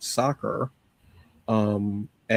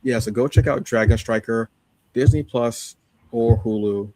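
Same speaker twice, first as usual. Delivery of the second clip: normal talking voice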